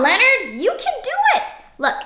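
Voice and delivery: ordinary delivery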